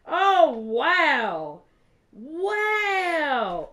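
loud, drawn-out wows; amazed. Real, not acted. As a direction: belt out each wow in astonishment. Amazed at contents of the package.

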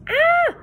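very high, loud scream of panic; terrified scream. A narrator's reading. Sid and Monty screaming at the monster, panicked scream. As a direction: shriek in pure fright. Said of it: "screamed Sid and Monty".

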